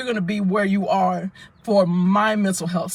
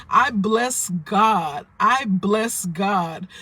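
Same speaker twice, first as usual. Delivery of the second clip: slow emphatic blessing; much slower than usual. Real, not acted. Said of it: "slow emphatic praise".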